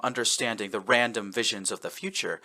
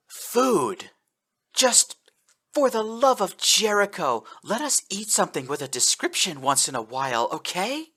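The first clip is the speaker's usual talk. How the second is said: exasperated outburst; 9 semitones higher than usual. Acted. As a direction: burst out, begging for a change.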